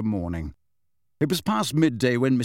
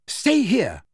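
firm, high and sharply raised; firm order; 9 semitones higher, 4 dB louder than usual. A narrator's reading. Mother ordering everyone to stay put, firm. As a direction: say it firmly, voice lifted high. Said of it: "she said firmly".

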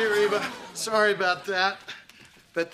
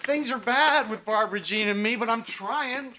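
ordinary talk

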